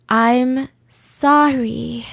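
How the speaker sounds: very slow, drawn-out apology; repeated apology. Retold or imitated. Suspect answering the interrogators, slow rehearsed apology. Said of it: "she just kept repeating".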